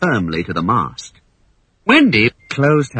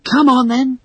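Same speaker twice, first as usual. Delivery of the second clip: put-on higher girl's voice, brisk; cheerfully rally the boys; Wendy calling the boys to go home; 13 semitones higher, 4 dB louder than usual. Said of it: "said Wendy".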